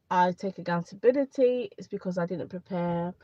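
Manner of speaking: usual speaking voice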